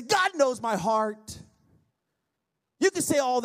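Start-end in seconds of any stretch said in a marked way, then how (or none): 0.0-1.4 drawn-out mock excuse; slow mocking quote. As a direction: drag it out mockingly. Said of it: "what they say".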